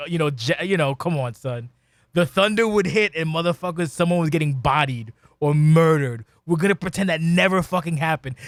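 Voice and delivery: ordinary talk, nothing marked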